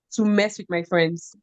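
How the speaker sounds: normal talking voice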